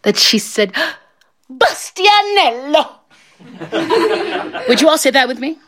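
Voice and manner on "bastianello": high, drawn-out joyful cry; overjoyed, hammed-up name call; bursting with excitement. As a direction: squeal the name out, stretched and high. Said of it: "She was so excited that she said".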